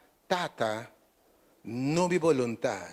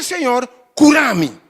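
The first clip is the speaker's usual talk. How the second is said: demanding healing out loud; loud cried prayer; 9 semitones higher, 16 dB louder, slower than usual. Shown as a demonstration. Preacher showing the wrong prayer to the congregation, loud demanding cry. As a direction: shout Senhor, curar-me, high and slow. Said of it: "Pero não diz".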